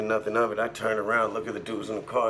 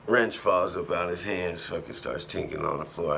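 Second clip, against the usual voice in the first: ordinary talk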